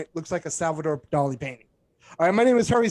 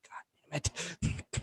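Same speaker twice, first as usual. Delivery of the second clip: much softer, slow defeated curse; muttered curse; 13 dB quieter, slower than usual. Retold or imitated. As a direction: sag and curse under your breath. His defeated reaction when caught.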